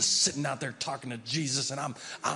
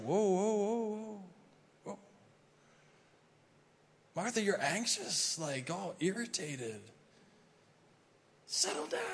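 soothing Jesus; 7 semitones higher than usual. Acted. Jesus calming irritated Martha.

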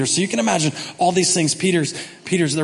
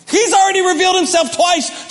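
normal talking voice

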